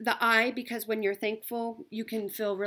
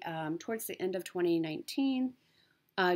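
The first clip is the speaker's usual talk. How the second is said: usual speaking voice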